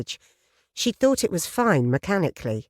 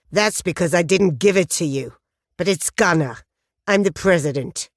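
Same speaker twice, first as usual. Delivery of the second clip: lightly voiced cold biker; 3 dB louder than usual. Biker answers coldly.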